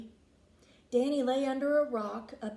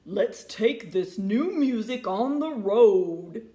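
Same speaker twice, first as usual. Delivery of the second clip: loud, bold bullfrog; big froggy rallying cry; 2 semitones lower, 6 dB louder than usual. A narrator's reading. Bullfrog urging the band onto the road, loud froggy voice. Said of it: "bellowed the bullfrog".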